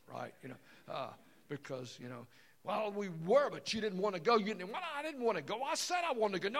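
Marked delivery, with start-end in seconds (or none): 2.6-4.7 mock furious shout, higher and much louder; psycho husband. Husband yelling at wife over the steak, mock fury. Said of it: "he's gone from logical to psycho". 4.7-6.6 mock shouted reply, much higher and louder; arguing wife. Wife yelling back at husband, mock anger.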